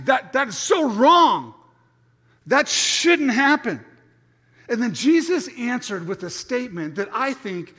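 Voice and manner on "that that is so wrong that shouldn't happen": loud, high, slow outcry; outraged protest. Acted. Preacher echoing Peter's shock, loud slow outcry. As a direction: cry out that it is wrong, slowly.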